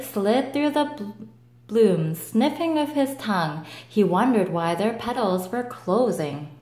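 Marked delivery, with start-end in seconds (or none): none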